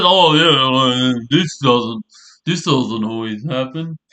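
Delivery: lightly voiced Steffi, a touch put-on; a hint of a dismissive woman brushing it off; mocking Steffi. Imitating Steffi brushing off the doctor, lightly mocking. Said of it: "oh, she said".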